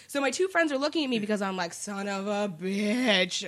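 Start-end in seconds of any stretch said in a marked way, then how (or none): 1.7-3.4 very slow, drawn-out mock curse; dawning realization. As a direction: stretch every word as it dawns on you. Sudden realization, retold.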